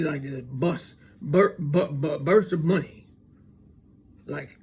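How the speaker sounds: normal talking voice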